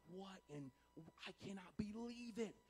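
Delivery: soft, hushed amazement; stunned disbelief. Acted. King staring at four men unharmed in the fire, softly stunned. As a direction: let it out quietly, dumbfounded. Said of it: "contrast to what he was just saying earlier".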